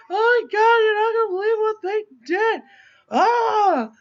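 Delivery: high, loud wailing sob; hammed-up bawl down the phone; mock bawling. Mom calling her husband on his break, mock bawling. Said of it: "bawling on the phone".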